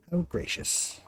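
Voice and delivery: dry unvoiced sneer; sarcastic whisper. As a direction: breathe it with sarcasm. Sarcastic character.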